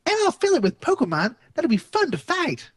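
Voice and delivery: high, scheming cheerful voice; gleeful villain voice. Acted. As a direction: sound pleased with your plan. Windsor voiced in story.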